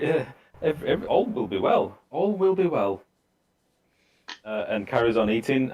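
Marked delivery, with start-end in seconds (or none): 0.0-3.0 a touch of nervous laughter, higher. Acted. Guest nervously dodging the question, mildly shaky. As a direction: repeat it uneasily, a little higher. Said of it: "laughs nervously, uh, and he's like".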